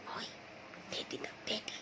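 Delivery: whispered teasing. Real, not acted. Owner whispers to her dog to give up the teddy.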